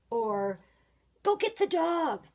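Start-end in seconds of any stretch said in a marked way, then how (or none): 1.2-2.2 high, loud urgent command; urgent shout. Shown as a demonstration. Teacher showing an exclamation, a high loud urgent call. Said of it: "Any of those are strong feelings".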